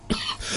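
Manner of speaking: short dry cough; real cough breaking the sentence; coughing fit. Real, not acted. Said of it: "I'm really coughing and spluttering here".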